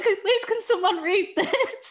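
high, slow pleading imitation through laughter; laughing mock plea. Retelling Leon waving the birth plan, laughing mock plea. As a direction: mimic Leon's pleading while cracking up.

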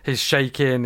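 ordinary talk, nothing marked

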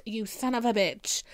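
usual speaking voice

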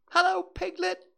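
put-on very high squeaky baby kangaroo; squeaky little Roo. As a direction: squeak it out like an excited little joey. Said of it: "he squeaked".

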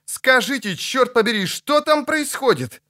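high, exasperated outcry; high-pitched frantic questioning; agitated demand. Acted. Agitated demand to know what happens.

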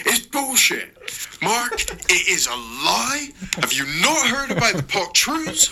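high, strained shout with a laugh. Acted. Eric shouting at Mark about the greater good, high. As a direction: bark it out in a high voice. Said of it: "shouted Eric".

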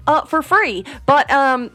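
gracious Southern sign-off; Southern accent. Shown as a demonstration. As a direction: thank the listeners in a put-on Southern accent. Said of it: "Can you close this out in an accent?".

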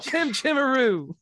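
high mock Cockney chimney sweep; bad Cockney accent. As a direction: lilt it in a terrible high Cockney. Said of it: "his bad Cockney accent".

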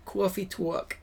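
exaggerated New York vowels; mock New York accent. Co-host joining the accent bit, mock New York accent.

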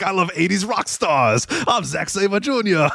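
put-on slow, high-pitched English accent gone Scottish; botched English accent. Co-host mimics the promo for laughs in a botched English accent. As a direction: draw out a hammed-up bad English accent. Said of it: "but he kinda sounded Scottish".

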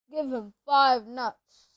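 sleepy. Acted. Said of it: "said Hagrid sleepily".

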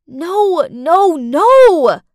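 loud, high, horrified no; inward scream. A narrator's reading. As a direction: scream it in dismay. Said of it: "I screamed inside my head".

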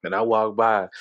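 ordinary talk, nothing marked

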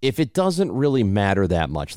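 usual speaking voice

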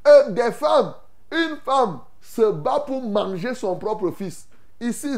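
ordinary delivery

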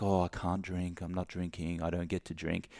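a quiet, defeated no; mopey refusal. Shown as a demonstration. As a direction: whine it as a sacrifice. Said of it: "It's not like".

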